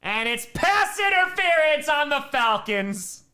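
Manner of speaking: loud, high excited call, stretched out slow; live play-by-play excitement over a penalty flag. Real, not acted. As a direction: shout it as the flag flies.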